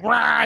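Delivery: shouted mock beast roar; werewolf roar. Storyteller imitating the werewolf mid-attack, loud roar. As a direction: roar it out like a monster. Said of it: "The werewolf's just like".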